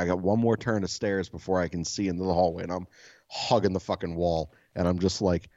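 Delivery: ordinary talk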